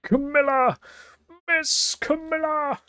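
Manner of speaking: a worried puppy calling for his mistress; Missile calling out. Acted. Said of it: "You enjoy my take on Missile?".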